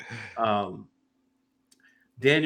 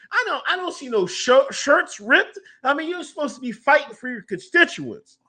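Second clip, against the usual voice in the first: high-pitched comic exasperation; worked-up, hammed-up complaint; mock outrage; 10 semitones higher than usual. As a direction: scold them in a high, incredulous voice. Mock outrage at politicians not fighting.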